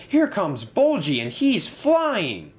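high, excited warning, drawn out at the end; alarmed cry. A narrator's reading. Thomas sees the bus flying, crying out. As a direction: call out the danger, stretching the last words. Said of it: "cried Thomas".

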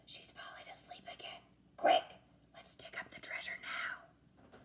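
breathless hushed whisper. A narrator's reading. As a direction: whisper so the baby sleeps. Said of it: "I whispered".

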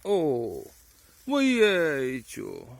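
loud, drawn-out dismay; dismayed exclamation. Real, not acted. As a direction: groan over a spoiled find. Finds a wormy mushroom.

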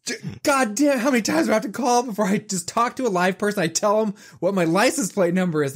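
high-pitched, fed-up ranting; exasperated mock rage, pitch way up; retold yelling. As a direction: re-enact a tirade at a phone system. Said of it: "just me yelling".

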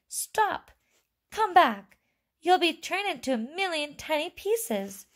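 high-pitched frantic mother; panicked screaming. A narrator's reading. Mother begs her son to come back, high panicked cries. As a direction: plead in a high storybook scream. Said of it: "screamed Missus Teave".

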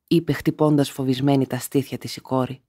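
ordinary delivery

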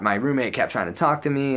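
ordinary delivery

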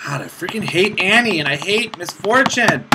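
ordinary talk, nothing marked

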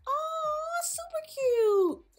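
high, slow cooing. Real, not acted. Player admiring her balloon, high slow coo. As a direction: coo over something adorable.

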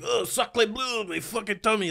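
lightly put-on French Canadian accent, high and slow; a hint of French Canadian, drawn out high; mock French Canadian. Seasick French Canadian man on the boat, mock French Canadian accent. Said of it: "French Canadian".

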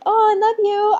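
sugary high mock "I love you"; mock sweet reality star. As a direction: lightly imitate a gushing hug moment. Mocking TV family hugging.

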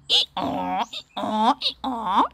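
loud mock hee-haw; donkey braying. Teacher plays donkey for children, loud braying. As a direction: bray like a donkey, over and over.

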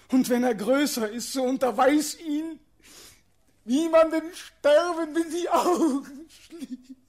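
rising, loud, near-tearful outcry; anguished farewell. Acted. Doomed prince telling the girl to teach a child, anguished.

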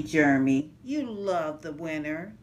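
usual speaking voice

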